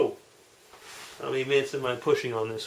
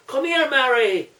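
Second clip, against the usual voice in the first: higher, louder call across the room; lightly voiced Irish father calling his wife; old Irish da calling out; 10 semitones higher, 5 dB louder than usual.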